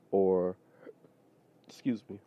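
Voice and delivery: hiccuping between words; hiccups. Real, not acted. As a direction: talk through a small hiccup. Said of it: "got the hiccups a little bit".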